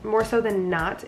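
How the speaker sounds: ordinary talk, nothing marked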